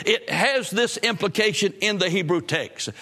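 normal talking voice

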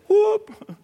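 short high-pitched whoop; imitated whoop. Preacher retelling friend who almost shouted, short high whoop. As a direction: mimic a sudden church shout. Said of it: "I almost shouted in there".

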